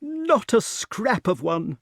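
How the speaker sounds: high, slow pronouncement; self-important. A narrator's reading. Pellinore confirms there is no king, self-important. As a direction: stretch it out with importance. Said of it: "feeling important".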